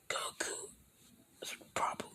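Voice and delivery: breathy secret whisper; hushed whisper to Bulma. Acted. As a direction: whisper it so only Bulma hears. Vegeta whispers again.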